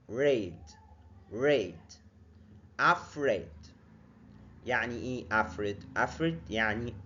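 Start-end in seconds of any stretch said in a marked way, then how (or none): none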